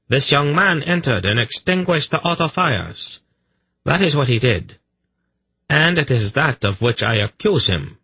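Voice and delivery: high-pitched, declamatory accusation; strident priest inquisitor. A narrator's reading. Priest inquisitor accusing the young man, strident. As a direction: accuse him as a sworn witness.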